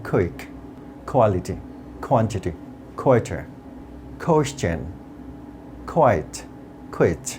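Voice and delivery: puffed K opens each, from "কুইক" to "কুইট"; word-list drill with airy K, as in "কোয়ালিটি"; aspirated Q words. Shown as a demonstration. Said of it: "সেগুলো আমি উচ্চারণ করছি". ("কুইক", "কোয়াইট"-এর ক খ-এর মতো)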